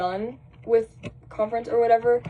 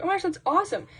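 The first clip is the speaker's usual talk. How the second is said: high excited gush; 9 semitones higher, much faster than usual. Retold or imitated. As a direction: squeal it out happily. Retold excited reply.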